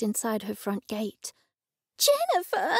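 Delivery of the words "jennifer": shriek. A narrator's reading. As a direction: shriek the name in a high voice. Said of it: "she shrieked".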